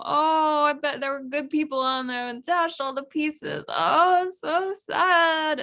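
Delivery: hammed-up sorrow; dramatic Miranda. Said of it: "and she's like".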